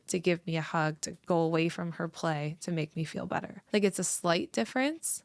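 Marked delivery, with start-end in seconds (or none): none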